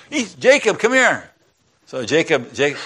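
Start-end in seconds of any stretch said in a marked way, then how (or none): 0.1-1.3 high-pitched call to a son. Acted. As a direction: summon him urgently, pitch well up. Mother calls her son over.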